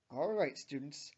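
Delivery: lightly voiced gruff coach. A narrator's reading. Coach ending the students' volleyball class, gruff. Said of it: "says Coach Hester gruffly".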